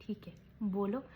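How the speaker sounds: imitating a cowed husband, barely whispered; meek whisper. Imitating husband Vicky giving in to his wife, meek whisper. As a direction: breathe it out like a man giving in. Husband forced to agree meekly.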